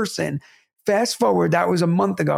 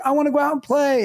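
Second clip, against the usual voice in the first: put-on higher, pleading child's request; eager kid; 8 semitones higher than usual. Kid asking dad to go out and play, eager. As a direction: mildly imitate a kid begging to play. Voices his kids asking.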